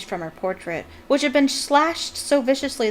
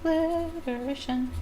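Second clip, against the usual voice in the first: higher, slow, drawn-out echo of the word "Alliteration"; savouring repeat; 7 semitones higher, 4 dB quieter than usual. Shown as a demonstration. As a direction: relish "Alliteration" slowly, up high. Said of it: "Good alliteration".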